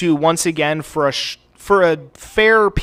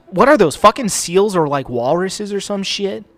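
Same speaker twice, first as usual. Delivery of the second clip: excited; 5 semitones higher, 4 dB louder than usual. Real, not acted. Spots seals in game.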